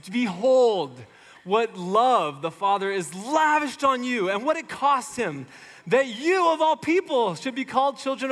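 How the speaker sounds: fervent sermon build; preaching climax. Acted. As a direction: proclaim it with rising fervor. Preacher reaching verse's climax.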